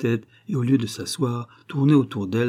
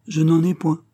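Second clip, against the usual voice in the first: high, firm little reply; reassured lamb; 7 semitones higher, 6 dB louder than usual. A narrator's reading. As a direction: answer with a reassured smile. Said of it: "avec un sourire rassuré, elle dit".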